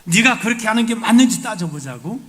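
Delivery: God challenging. Acted. God loudly challenging Jonah to argue the matter out. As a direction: dare him to argue it out. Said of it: "논쟁을 하실 수 있을".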